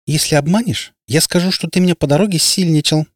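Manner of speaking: put-on distinct girl's voice. A narrator's reading. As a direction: say it suddenly and distinctly. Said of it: "вдруг внятно сказала девка".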